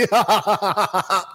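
drawn-out run of ha-ha-ha; long real laugh. Real, not acted. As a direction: burst out laughing at your own joke. Bursts out at own joke.

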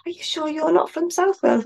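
high-pitched, lightly imitated Welsh questioner; South Wales local. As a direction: act out a local's doubtful question, pitched well up. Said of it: "So I went down to South Wales".

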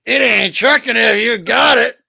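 high, loud, slow squawking Gilbert Gottfried voice; Gilbert Gottfried impression. Co-host redoes the line as Gilbert Gottfried. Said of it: "Gilbert Godfrey".

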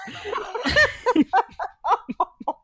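high-pitched helpless laughter; laughter spilling out; high laughing fit. Real, not acted.

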